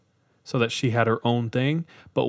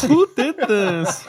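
laughing; 12 semitones higher, 5 dB louder, much slower than usual. Retold or imitated. Mocking parents, laughing along.

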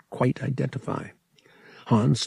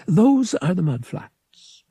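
slow, higher priest voice; lightly voiced priest guide; 9 semitones higher, 4 dB louder, slower than usual. A narrator's reading. Veretil voiced by narrator.

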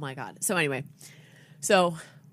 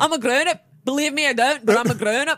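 put-on brash drunk grown-up; 6 semitones higher, 6 dB louder than usual. Drunk adult bit continues.

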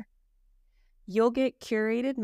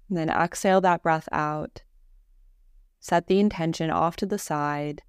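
guided meditation; 3 semitones lower, 6 dB louder than usual. Real, not acted. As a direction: guide them calmly through each breath, unhurried. Leads a guided Yoga Nidra.

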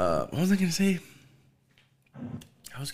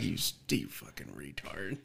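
much softer, lower mock self-insult; self-scolding mutter; 8 semitones lower, 14 dB quieter than usual. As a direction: grumble at yourself under your breath. Scolding himself over tuning.

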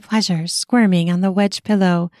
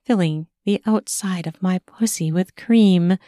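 usual speaking voice

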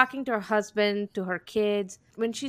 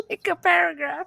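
laughing; 9 semitones higher than usual. Real, not acted. Laughing retelling.

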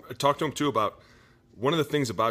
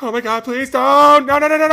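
mock panicked plea; 16 dB louder than usual. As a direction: beg like he's running for his life. Fleeing defender begging, imitated.

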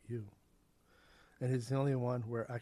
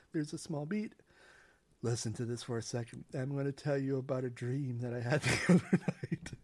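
usual speaking voice

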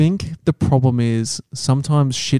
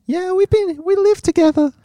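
put-on high-pitched girlfriend. Playing own girlfriend greeting the co-host's girlfriend, falsetto. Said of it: "My girlfriend's got quite a high-pitched voice".

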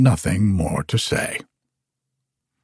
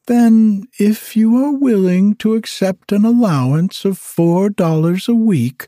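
much higher, businesslike rich lady; haughty lady; 9 semitones higher than usual. Acted. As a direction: lay down her terms grandly. Miss Midas bargains, high.

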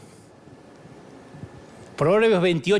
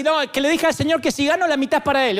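mocking gambler; 7 semitones higher, faster than usual. Believer at Las Vegas bragging about his bet, hammed up. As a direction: ham up a believer's bet with God. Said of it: "Me dijo uno así".

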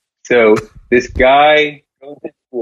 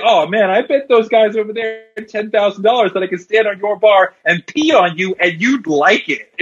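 smug gambler; 9 semitones higher than usual. Acted. Gambler reveals punchline.

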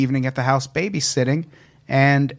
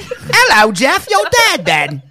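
very high, loud mock British, laughing; British accent; 12 semitones higher, 5 dB louder, slower than usual. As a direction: ham up a cockney ghost. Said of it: "weird British accent".

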